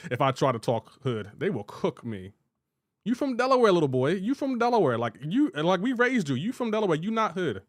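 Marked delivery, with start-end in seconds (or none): 3.0-7.6 high-pitched mock parental scolding, "You from Delaware". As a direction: play the parent cutting him down to size, pitched up. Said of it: "my parents will cook me".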